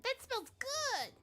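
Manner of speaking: put-on child voice. Child NPC line.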